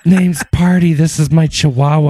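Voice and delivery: high breathy socialite voice; Paris Hilton. Retold or imitated. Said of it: "Party Hilton".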